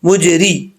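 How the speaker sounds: ordinary talk, nothing marked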